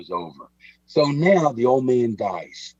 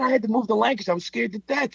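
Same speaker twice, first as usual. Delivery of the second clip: much higher, put-on voice of an indignant old gangster; old Pete's voice; 11 semitones higher, faster than usual. Telling Mike about his father, indignant high old-man voice. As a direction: play Pete, outraged he laughs. Voicing old Pete, retold.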